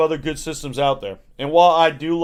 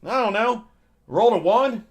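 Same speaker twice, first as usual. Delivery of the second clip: much higher, unsure guessing voice; clueless Sally; 9 semitones higher than usual. Retold or imitated. Coworker Sally guessing a D&D term for George, high and unsure. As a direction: guess it like a question.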